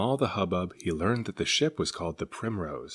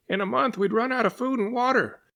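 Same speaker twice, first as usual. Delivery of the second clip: much higher, fretful passenger voice; anxious passenger pleads with the captain; alarmed passenger; 4 dB louder than usual. Acted. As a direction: worry about running out. Passenger worried.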